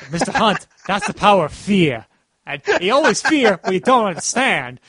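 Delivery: hammed-up Chicago mobster-film accent; over-the-top Chicago accent. Fan imitating Falcone for his co-host, exaggerated Chicago accent. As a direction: lay on a thick, mock Chicago accent. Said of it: "that over-the-top Chicago accent".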